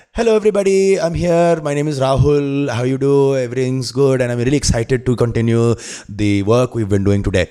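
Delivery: chatty north Indian accent. Shown as a demonstration. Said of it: "So if you're gonna go north".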